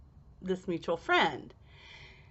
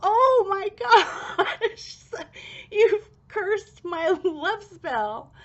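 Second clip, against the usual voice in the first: high, loud laughing shock, slowed; 11 semitones higher, 6 dB louder, slower than usual. Retold or imitated. To the friend, laughing high and loud in shock. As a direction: laugh it out in disbelief. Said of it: "I was like".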